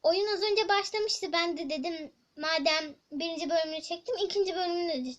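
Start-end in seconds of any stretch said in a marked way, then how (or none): none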